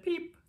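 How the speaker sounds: high, soft peep; a tiny chick's peep, way up high. Acted. Baby chick peeps hello to the truck, high and soft. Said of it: "her chick said".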